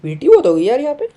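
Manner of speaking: high, loud fed-up exclamation; exasperated outburst. Real, not acted. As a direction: blurt it out, fed up. Frustrated outburst at the game.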